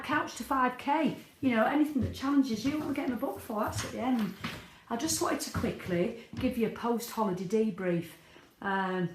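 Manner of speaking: normal talking voice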